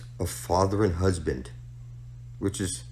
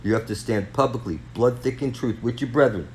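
ordinary talk, nothing marked

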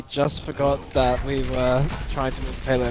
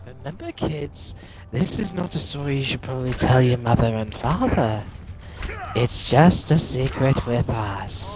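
creepy stranger voice; 4 semitones lower than usual. Acted. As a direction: whisper a secret, creepily. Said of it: "You sound like someone that I would keep my kid away from".

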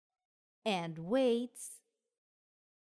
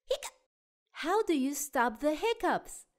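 ordinary delivery, nothing marked

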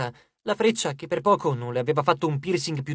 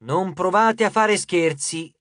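slow, deliberate reading of demands; emphatic, measured message reading; reading aloud; 3 semitones higher, 3 dB louder, slower than usual. A narrator's reading. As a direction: read out a robber's note, each order weighted. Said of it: "Lei lesse ad alta voce il messaggio".

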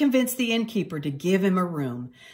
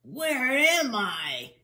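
slow, moaning wake-up voice; groggy grumble; 4 semitones higher, much slower than usual. Acted. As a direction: groan it out, dazed. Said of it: "he grumbled groggily".

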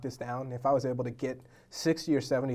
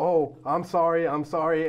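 slow, louder over-the-top apology; mock apologizing celebrity; 4 semitones higher, 7 dB louder, slower than usual. Teller imitating Patton Oswalt blocking him at the gym, hammed-up apology. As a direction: ham up a flustered sorry. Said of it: "completely just apologizing".

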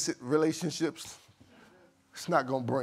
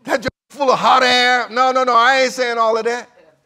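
loud and higher, insisting he still wants Peter; emphatic reassuring shout; 8 semitones higher, 12 dB louder than usual. Acted. The messenger insisting to the disciples that Peter is still wanted, loud and emphatic. Preacher voices the angel's or Jesus' reply.

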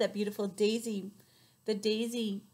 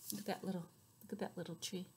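ordinary delivery

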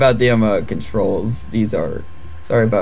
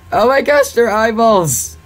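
shocked exclaim; 9 semitones higher, 4 dB louder than usual. Real, not acted. Watching the battle, she cries out amazed at the eyeballs.

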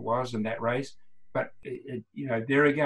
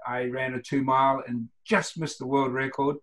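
usual speaking voice

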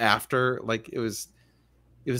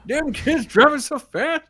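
high, loud put-on old-man voice; hammed-up cranky old-timer complaint; grumpy old man; 13 semitones higher, 5 dB louder than usual. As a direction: gripe like an old man at speeding cars. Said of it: "Old man yells at cars".

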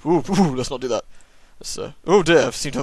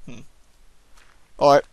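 ordinary talk, nothing marked

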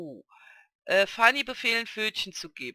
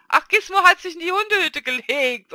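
higher and louder, delighted surprise; excited discovery; 11 semitones higher, 10 dB louder than usual. Real, not acted. Gamer spotting the dog in the kennel, excited. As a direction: burst out with delight at the sight.

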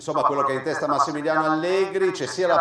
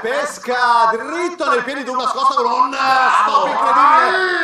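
breathless italian goal commentary; 8 semitones higher, 4 dB louder than usual. Acted. Goal commentary replayed, rising to shout.

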